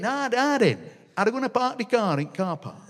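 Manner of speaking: put-on lightly sent-up Sheffield kid; Sheffield accent. Child asking dad in broad Sheffield. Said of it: "they got this wide A".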